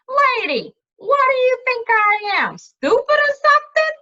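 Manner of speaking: high, loud, affronted outburst; indignant retort. Acted. Jack scoffing at Cimmerian's giant-killer question, loud and indignant. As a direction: scoff at the very idea, voice shooting up.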